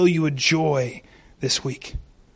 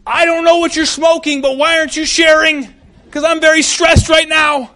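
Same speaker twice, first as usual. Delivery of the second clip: high, loud, frantic joking voice; stressed servant; 10 semitones higher, 8 dB louder than usual. Retold or imitated. Servant mocks Elisha, high, loud and frantic. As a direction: play the freaked-out servant for laughs. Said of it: "imagine the servant looking around going".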